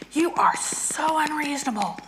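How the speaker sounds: hissed at the bear, high and breathless; angry whisper. Shown as a demonstration. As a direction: whisper the accusation, seething. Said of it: "so I'm angry at my husband".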